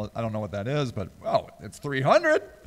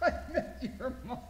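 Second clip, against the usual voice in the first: laughing; 3 semitones higher, 13 dB quieter, much faster than usual. Real, not acted. Host reading a "how" suggestion, laughing. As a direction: squeeze it out while cracking up.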